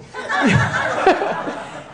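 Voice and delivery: breathy high-pitched burst of laughter; a real laugh breaking out. Real, not acted. Laughing at being called moron.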